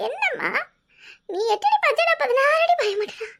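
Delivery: mock shock. Daughter feigns shock at mother.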